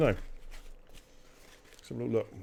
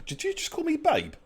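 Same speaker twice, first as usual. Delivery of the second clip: surprised. Real, not acted. Caught off guard mid-sentence.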